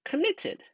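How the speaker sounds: committed sung out high, well above the line before; target word stressed. Shown as a demonstration. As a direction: lift "committed" way up as the reveal. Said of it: "create the word".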